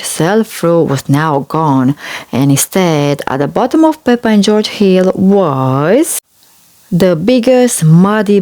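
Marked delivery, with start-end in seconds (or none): none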